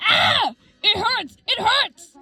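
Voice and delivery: high, acted cries of pain; screaming in pain. A narrator's reading. Bitten Dandy cries out, high pained screams. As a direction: yelp each phrase high as if bitten. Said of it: "Dandy screamed in pain".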